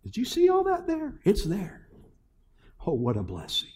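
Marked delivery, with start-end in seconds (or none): none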